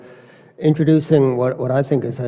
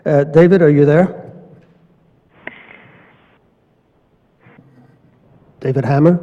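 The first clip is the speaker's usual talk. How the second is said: raised voice, calling into the line; 3 semitones higher, 8 dB louder than usual. Real, not acted. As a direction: call out loudly for him. Calling for absent remote speaker.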